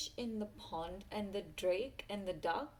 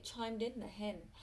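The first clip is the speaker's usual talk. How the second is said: ordinary delivery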